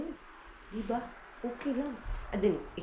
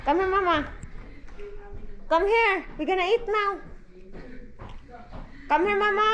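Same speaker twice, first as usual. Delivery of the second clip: loud, higher call building up; 7 semitones higher, 4 dB louder than usual. Real, not acted. Mother calls her toddler over to eat, loud and rising. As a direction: call the little one to come eat.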